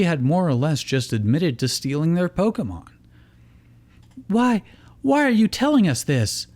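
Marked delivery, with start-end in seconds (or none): 4.3-6.5 high, halting and shaken; stammering. Acted. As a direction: stumble over the words, rattled. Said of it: "May stammered".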